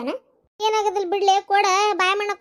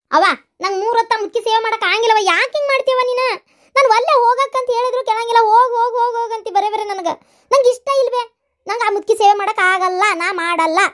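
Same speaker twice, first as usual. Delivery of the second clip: angry protest; 5 semitones higher, 5 dB louder than usual. Acted. Wife refuses to nurse mother-in-law.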